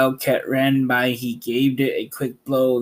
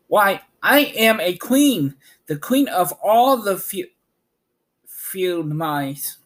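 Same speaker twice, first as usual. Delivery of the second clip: indignant pride; 6 semitones higher than usual. A narrator's reading. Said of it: "cried the little animal indignantly".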